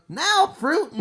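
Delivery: high-pitched mock yell. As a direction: call it out high, as a retold yell. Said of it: "he yelled out".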